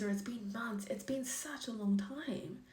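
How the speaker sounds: whispered in quiet disbelief; disbelieving whisper. Retold or imitated. To a lover who spoke up too late, whispered disbelief. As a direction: breathe it out, stunned.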